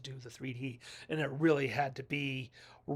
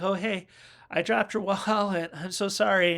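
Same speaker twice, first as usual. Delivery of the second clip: higher, louder sheepish apology with a laugh; giggly apologetic girl, louder; mock apologetic Vera; 5 semitones higher, 7 dB louder than usual. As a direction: play the girl who dropped the wallet, a bit loud.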